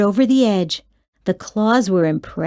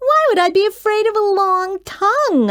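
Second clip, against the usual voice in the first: put-on scoffing child; 10 semitones higher, faster than usual. A narrator's reading.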